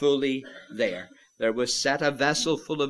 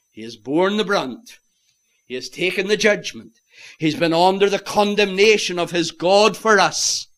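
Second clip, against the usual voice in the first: loud, raised pulpit cry; impassioned proclamation, building; forceful preaching; 5 semitones higher, 8 dB louder than usual. Real, not acted. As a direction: drive each phrase higher and louder.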